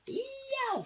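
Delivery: very high screeching cat yowl; shrill alley-cat cry. Acted. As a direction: yowl it out like a tough tomcat. Cat Scarface Claw yowls.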